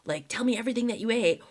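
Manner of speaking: high panicky question, then a rushed whisper; mock frantic mom; hammed-up anxious mother grilling her kid; freaking out. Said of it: "kind of freak out and be like".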